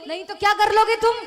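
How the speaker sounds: loud, high, fast retort; defiant challenge. Acted. Wife waves the rolling pin and challenges her husband. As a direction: fire it back, daring him.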